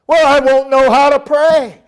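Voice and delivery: high, loud pleading why; whiny objector. Retold or imitated. Preacher voicing a member demanding reasons, high and whiny. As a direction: whine like a stubborn churchgoer. Mock dialogue with congregant.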